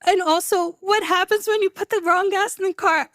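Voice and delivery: retold panic. Retold panic in the failing car.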